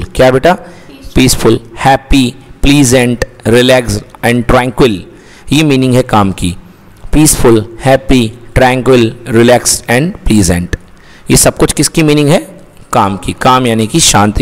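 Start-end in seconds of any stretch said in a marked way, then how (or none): 1.1-5.0 slow, deliberate listing of words; slow reading. Shown as a demonstration. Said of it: "teacher reads list slowly". 7.1-10.8 drawn-out, very slow listing; very slow reading. Shown as a demonstration. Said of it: "teacher repeats list slowly".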